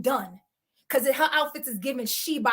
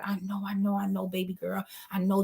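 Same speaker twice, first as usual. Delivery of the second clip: soothing comfort; 5 semitones lower, 6 dB quieter than usual. Retold or imitated. Phil comforting her.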